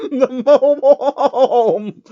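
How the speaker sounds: high giggly laugh breaking through; can't keep it together, laughing. Real, not acted. Reader cracking up mid-sentence, laughing.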